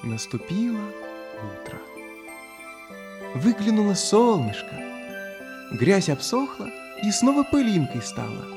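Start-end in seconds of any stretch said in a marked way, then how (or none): none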